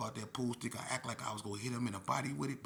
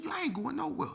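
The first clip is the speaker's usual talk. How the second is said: very high, strained retort; defiant refusal; 5 dB louder than usual. Retold or imitated. Youth refusing to go to his room, defiant. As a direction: refuse loudly, voice pushed way up.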